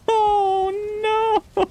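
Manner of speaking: high, squealing oh no; half-laughing, half-crying oh no; delighted cry. Real, not acted. Overwhelmed by tribute.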